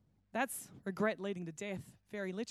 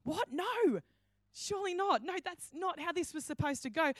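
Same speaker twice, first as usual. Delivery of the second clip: high, pleading disbelief; like watching it all go wrong; a touch of panicked Judas; dismayed disbelief; 5 semitones higher than usual. Retold or imitated. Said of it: "looking around going".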